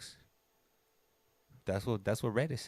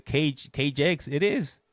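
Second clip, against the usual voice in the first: higher, louder mimicry of the wife; playful, slow, raised-pitch quote of the wife; imitating wife giving in; 8 semitones higher, 8 dB louder, slower than usual. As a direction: stretch it out as a mock concession. Wife's line redone with correction.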